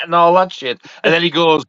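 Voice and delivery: ordinary talk, nothing marked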